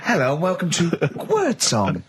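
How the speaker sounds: high, showy quiz host welcome; mock game show host. Mock-welcoming listeners to the new quiz as a cheesy host.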